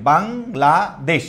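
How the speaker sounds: Bangladesh slowly split as বাং, লা, দেশ; in three parts. Shown as a demonstration. Teacher showing students three syllables, Bangladesh slowly in three parts. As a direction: break Bangladesh into three separate beats. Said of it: "আমরা এটা তিন পার্টে উচ্চারণ করছি".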